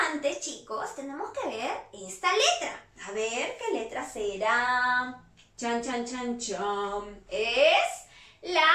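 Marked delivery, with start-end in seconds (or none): 5.6-7.2 low, soft "chan chan" build-up; suspense drumroll. Pretend. Teacher teasing children before revealing the letter, suspense drumroll. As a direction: voice a mock drumroll, last one held.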